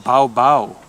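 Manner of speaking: Italian dog bark. Shown as a demonstration. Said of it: "but in Italian".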